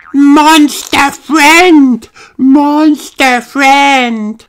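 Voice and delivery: put-on very high, loud, drawn-out toddler cheer; excited George. A narrator's reading. George tells his family about the monster, jumping with excitement. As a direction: chant it slowly at a tiny child's top pitch. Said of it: "jumping up and down".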